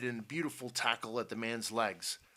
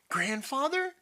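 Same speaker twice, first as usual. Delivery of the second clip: loud, very high question; astonished; 5 dB louder than usual. A narrator's reading. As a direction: call out in startled disbelief. Tom astonished by grandfather's arrival.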